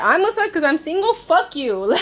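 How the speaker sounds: high, outraged comeback; retold outrage, pitched high; indignant. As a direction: fire it back high and offended. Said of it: "I'm like".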